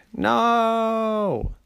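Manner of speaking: long, high, loud cry of dismay; drawn-out high-pitched protest; child's wail. Acted. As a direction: wail it out like a horrified kid. Kids protest dentist taking candy.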